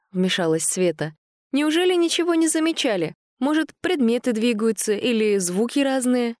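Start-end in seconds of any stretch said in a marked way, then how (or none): 1.5-6.3 put-on high, eager girl; young woman voice. A narrator's reading. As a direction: voice her higher and curious. Sveta questions the antiquarian.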